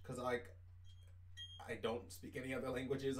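usual speaking voice

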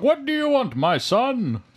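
high, hammed-up Santa voice; put-on Santa voice, pitched well up; mall Santa. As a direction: play a mall Santa greeting a kid. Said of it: "And says, like".

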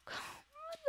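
soft breathy sigh. Real, not acted. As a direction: let out a dismayed sigh. Dismayed after losing home in game.

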